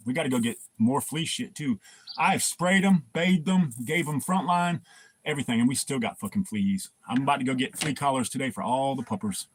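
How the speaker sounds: ordinary talk, nothing marked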